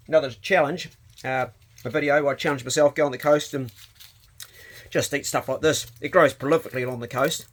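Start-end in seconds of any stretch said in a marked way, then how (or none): none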